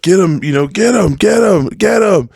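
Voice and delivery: high, urgent cheering, not at full volume; imitated crowd yelling. Crowd egging on two fighting kids, mock yelling. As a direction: mimic spectators egging on a fight. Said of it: "there's people screaming on both sides".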